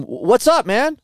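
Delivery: high, loud confrontational what's up; retelling a loud confrontation at the track; loud challenge. Retold or imitated. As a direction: call him out loudly. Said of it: "I'm, I'm loud".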